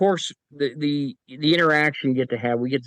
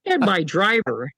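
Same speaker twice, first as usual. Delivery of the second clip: usual speaking voice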